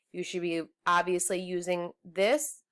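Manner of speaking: ordinary delivery, nothing marked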